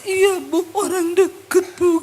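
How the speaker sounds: acted tearful whine; imitated crying. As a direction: mimic a woman answering through tears. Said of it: "Dia nangis".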